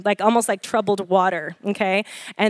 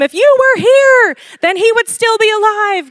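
high, loud, accusing cry; 11 semitones higher, 6 dB louder than usual. Retold or imitated. Mourners accusing Jesus of coming too late. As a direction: fling the blame at Jesus. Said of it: "There's this accusation brought to him".

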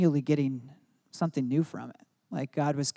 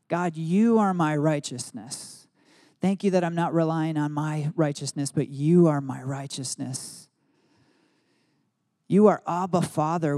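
earnest prayer; 3 dB louder than usual. Shown as a demonstration. Voices his own prayer.

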